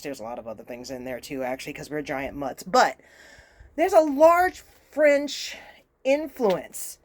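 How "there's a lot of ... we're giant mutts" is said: much lower, softer, quick parenthesis; muttered aside. Real, not acted. Louisiana native qualifying her French-heritage claim, muttered aside. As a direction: toss it away under the main point.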